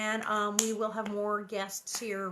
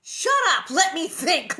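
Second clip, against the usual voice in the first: loud, higher screech; 7 semitones higher, 12 dB louder than usual. A narrator's reading. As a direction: snap at her sister in panic. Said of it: "Laura screeched".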